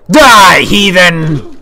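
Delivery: loud high zealot shout; battle cry. Acted. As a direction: scream it like a crusader. Striking an enemy.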